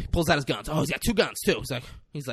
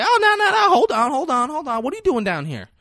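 high-pitched, urgent hold-on; imitated high, hurried protest; mock alarmed interruption; 12 semitones higher, 4 dB louder than usual. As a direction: cut in high, as if halting someone. Denzel halting the ranting girl.

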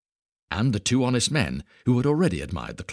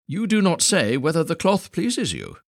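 sly weaver; 9 semitones higher, 5 dB louder than usual. Acted. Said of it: "said one of the weavers".

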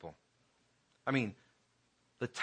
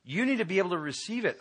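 higher, louder and fast; urgent raised plea; urgent insistence; 8 semitones higher, 4 dB louder, faster than usual. Retold or imitated. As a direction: press him to accept. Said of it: "I said".

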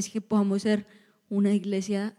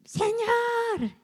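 mock cry out; 13 semitones higher, 4 dB quieter than usual. Blind man calling to Jesus, acted high cry. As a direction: imitate a beggar crying out for help. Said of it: "Cuando él empezó a gritar".

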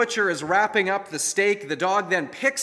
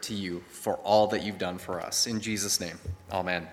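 low, soft prayer voice; 8 semitones lower, 5 dB quieter than usual. Acted. Pastor praying softly before the word. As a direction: pray quietly over the congregation.

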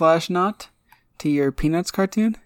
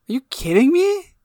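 high-pitched disbelieving question; incredulous protest; 6 semitones higher, 3 dB louder than usual. Real, not acted. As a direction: shoot way up in mock-outraged disbelief. Protests host's disagreement.

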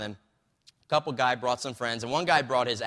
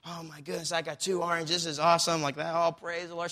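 put-on higher, joyful old lady voice; a sleepy lady finding two oranges, overjoyed; delighted lady; 6 semitones higher than usual. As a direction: sound thrilled with the gift.

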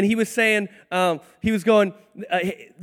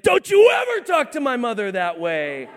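higher, shouted protective outburst, imitated; indignant mock scolding; 4 semitones higher, 4 dB louder than usual. Son defending mom from dad, indignant mock shout. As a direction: shout it like a fiercely protective boy.

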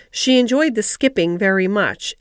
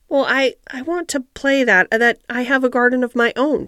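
put-on hesitant, higher child's stammer; 3 semitones higher than usual. Mary, afraid to answer the gardener, stammers nervously. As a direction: stumble over the first words, almost afraid to answer. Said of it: "she stammered".

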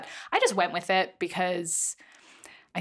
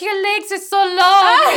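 put-on much higher, louder, slow drunken squeal; drunk woman; 12 semitones higher, 13 dB louder, slower than usual. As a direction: slur it up high like the drunk clubgoer. Drunk woman climbing her legs.

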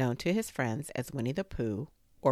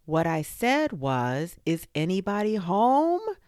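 louder, high, insistent holler rising at the end; very loud call; 6 semitones higher, 7 dB louder than usual. A narrator's reading. Bear calls again into a silent burrow, very loud. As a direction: repeat it loudly and emphatically. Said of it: "called out Pooh very loudly".

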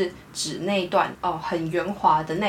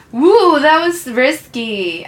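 mock relief; 6 semitones higher, 8 dB louder, much slower than usual. Acted musician after risky passage.

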